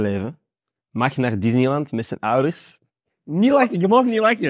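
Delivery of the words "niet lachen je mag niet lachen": suppressed giggles. Real, not acted. Teasing friend not to laugh.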